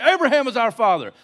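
high, loud protest; indignant outburst. Retold or imitated. Offended crowd answering Jesus back, indignant outburst. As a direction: rise up and pop it out loudly. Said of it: "They rise up".

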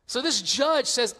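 ordinary delivery

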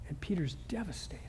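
hushed whisper of grief; a whispered aside about Peter's devastation. A narrator's reading. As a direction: breathe it out as a whisper.

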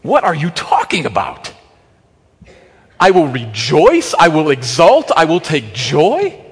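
baffled questioning of the verse; incredulous. Retold or imitated. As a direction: ask it as if you can't believe it. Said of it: "To which I respond".

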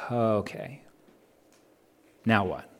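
soft, low, let-down murmur; deflated. Retold or imitated. Preacher recalling the hour after the sermon's done, quietly deflated. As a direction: trail into a quiet, spent question after the build-up. Retelling the post-sermon letdown.

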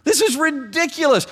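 very high, drawn-out indignant squeal; hammed-up indignation, stretching the insult way up high; mock exasperated outrage. God rebuking Ahaz's fake piety, comic outrage. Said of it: "And, and God says".

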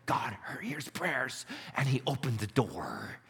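hushed, reverent whisper; whispered awe. Real, not acted. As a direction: drop to a breathy hush for effect. Preacher drops to a whisper.

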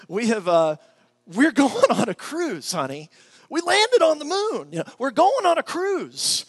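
high, loud mock-giddy announcement; telling his wife they won a cruise, high and loud mock-giddy; gushing to wife. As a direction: oversell it like you hit the jackpot. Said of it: "go out and say".

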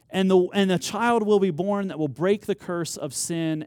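loud, high, driving sermon voice; full-force preaching. Acted. Pastor retelling Abraham's promise to the church, full-force preaching. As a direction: preach it out, building the fire.

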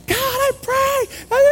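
high, slow, strained shout; retold yell. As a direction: yell a prayer over loud worship. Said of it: "I'm, like, yelling in their ear".

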